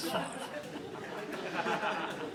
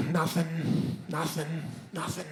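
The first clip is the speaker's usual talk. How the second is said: each nothing softer and softer; deflated, fading; 4 semitones lower, 10 dB quieter, slower than usual. Retold or imitated. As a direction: let the hope drain out. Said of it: "and I'm like".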